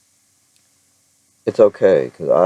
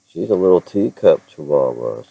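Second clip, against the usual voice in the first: ordinary delivery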